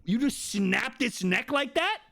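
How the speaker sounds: mock horror at the neck snap, slow and high; appalled disbelief. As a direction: stretch it out, aghast.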